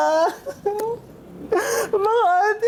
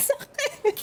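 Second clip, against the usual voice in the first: pained squeal; 12 semitones higher, 6 dB quieter than usual. Real, not acted. Burned again while lighting.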